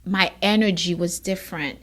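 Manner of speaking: ordinary talk, nothing marked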